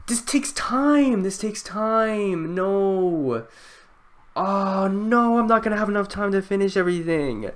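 high, drawn-out whine of dismay; dismayed wail. Real, not acted. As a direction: wail it high, stretching the words. Dismay at running out of time.

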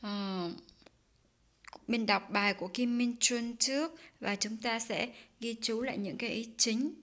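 ordinary talk, nothing marked

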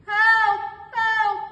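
high, loud panicked cry for help; wolf crying for help. Acted. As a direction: scream it out as he's going under. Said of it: "cried the wolf".

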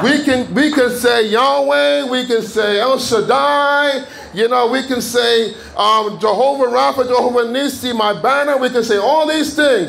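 high, chant-like roll call of names; fervent preaching. Real, not acted.

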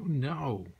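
a sudden loud flat refusal; a sharp loud no from the sulky sister. A narrator's reading. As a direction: bark the refusal. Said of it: "she said".

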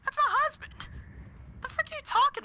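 high, indignant retort; mock indignant. Real, not acted. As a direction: scoff back in mock outrage. Retorting to character.

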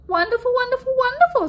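high, gushing praise; gushing Zelda. Acted. Zelda admiring Phoebe's new wings, high and gushing. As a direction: swoon over each wonderful like a fussy stylist. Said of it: "said Zelda".

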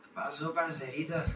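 put-on Colombian accent. Asked to imitate a Colombian for friends, soft Colombian accent. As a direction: try a soft Colombian lilt. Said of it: "El acento colombiano".

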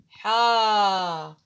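teacher sounding a long vowel. Shown as a demonstration. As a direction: stretch حا with a long alif. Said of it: "ماذا أقول لها؟".

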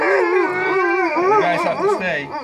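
loud, slow, drawn-out command to dogs; loud stern command. Real, not acted. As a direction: call out firmly so the dogs stay put. Holding back the dogs.

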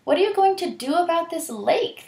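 high anxious question; animals' question. Acted. Animals asking Hyena about the lake, high anxious. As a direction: ask it worried.

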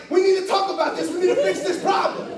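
high, urgent mock insistence; acted pushy, strained demand; insistent fixing. Acting stressed self over the bed.

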